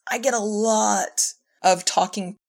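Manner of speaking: usual speaking voice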